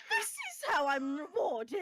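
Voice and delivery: loud, high indignant cry; shrieking hostess. Acted. Hostess protesting how her hospitality is repaid, shrieking. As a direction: cry out, wronged and outraged. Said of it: "You can hear her shrieking".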